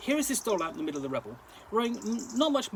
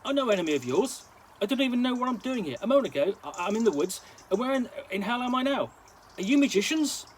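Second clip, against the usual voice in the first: agitated plea; 6 semitones higher, 4 dB louder than usual. A narrator's reading. Doll pleads, confused and alarmed.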